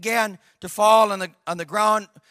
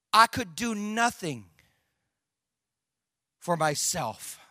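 slow and deliberate, dropping softer and lower at the end; slow, weighty statement; 4 semitones lower, 5 dB quieter, slower than usual. Real, not acted. Preacher telling congregation of helplessness, slow and grave. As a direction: let each phrase sink in, with a long pause.

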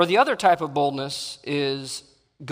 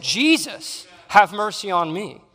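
a high, loud cry for mercy; crying out louder; 5 semitones higher, 6 dB louder, slower than usual. Retold or imitated. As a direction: call out desperately over the crowd. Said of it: "He went all the louder and said".

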